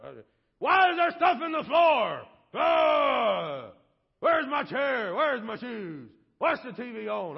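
loud, irritated rant at the family; hammed-up cranky dad, yelling; grumpy complaining husband. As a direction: storm in griping about everything. Acted grumpy husband coming home.

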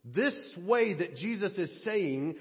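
usual speaking voice

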